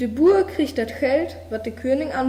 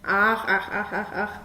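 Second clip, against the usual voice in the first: frog croak. A narrator's reading. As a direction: croak each ach like a frog by the pond. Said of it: "Die Poggen robt over immer wieder". (leises Froschquaken)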